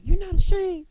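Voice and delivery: high, loud disbelieving question; shocked friend. Retold or imitated. Pastor friend asking if she's ashamed, high and loud. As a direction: play the scandalised friend. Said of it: "She said".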